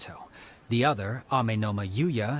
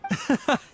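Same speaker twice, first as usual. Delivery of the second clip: a light, high little laugh; quick chuckle of amusement; short amused laugh; 13 semitones higher than usual. Acted. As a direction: laugh off his modesty. Amused at humble hero.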